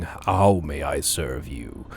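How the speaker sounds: low, slow, looming figure; deep rumbling voice. A narrator's reading. Game master voices shadowy figure greeting intruders, deep rumbling. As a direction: rumble it slowly from the depths. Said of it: "deep rumbling voice saying".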